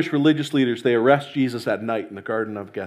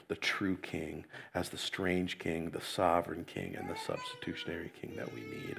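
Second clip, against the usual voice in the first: hushed pastoral prayer, trailing off softly; 7 semitones lower, 14 dB quieter than usual. Real, not acted. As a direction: pray gently, almost under the breath. Pastor prays before the sermon.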